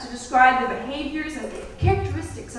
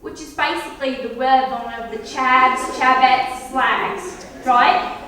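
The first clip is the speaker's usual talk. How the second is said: louder mock Cockney accent; mock Cockney, loud and brash; 6 dB louder, slower than usual. Vlogger mocking Cockney speakers, loud mock Cockney accent. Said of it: "we have the Cockney accent".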